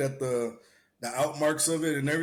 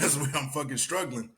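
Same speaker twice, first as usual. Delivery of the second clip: ordinary talk, nothing marked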